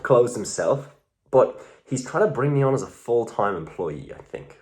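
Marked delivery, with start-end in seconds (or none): none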